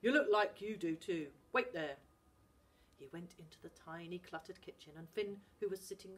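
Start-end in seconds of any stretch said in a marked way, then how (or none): 0.0-2.0 louder, curt; gruff Scottish dad. Acted. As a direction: send the boy to wait. Dad goes for tea.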